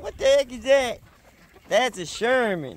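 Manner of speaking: ordinary delivery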